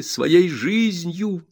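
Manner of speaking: жизнью drawn out very slowly, high; stressed repetition. A narrator's reading. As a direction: lean on жизнью, stretching it. Repeats for emphasis. (медленно и с нажимом: «жизнью»)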